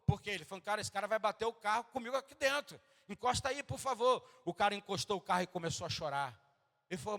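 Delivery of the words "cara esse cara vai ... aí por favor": panicked plea. Retold or imitated. Driver panics, asks to stop.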